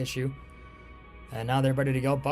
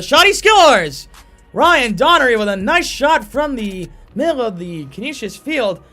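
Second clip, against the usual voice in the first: loud, high goal call; long goal yell; 6 semitones higher, 9 dB louder than usual. Real, not acted. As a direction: yell the score, building. Goal call by play-by-play announcer.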